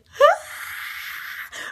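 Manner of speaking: high-pitched mock gasp of fright; Nick's startled gasp. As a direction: gasp sharply, imitating a scared reaction. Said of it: "straight up with Nick's reaction".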